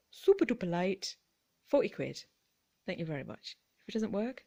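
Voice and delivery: ordinary delivery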